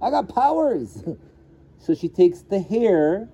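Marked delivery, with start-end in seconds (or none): 0.0-1.0 higher, mock-surprised realization of a goddess; light hammed-up 'wait, I have powers'; sudden idea. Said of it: "It's like".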